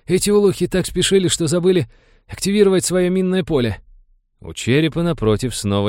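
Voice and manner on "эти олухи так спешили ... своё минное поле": high, louder crewman's wheeze; relieved wheeze. A narrator's reading. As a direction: let relief out in a high croak. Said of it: "с облегчением просипел Фаламор".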